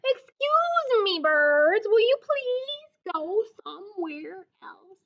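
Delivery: high, slow, careful asking; polite Gerald. Acted. Gerald asks the birds to leave, high and polite. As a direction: Gerald on his best manners.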